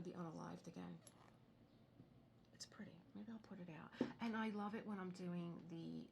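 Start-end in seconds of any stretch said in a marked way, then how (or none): 2.5-3.9 soft unvoiced whisper; whispered aside. Real, not acted. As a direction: breathe it to yourself.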